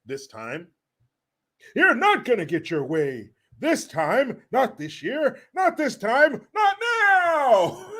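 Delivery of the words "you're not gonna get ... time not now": climbing to a high wailing cry; hammed-up rant breaking into a squeal; mock yelling impression. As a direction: imitate a ranting speaker, higher with each phrase.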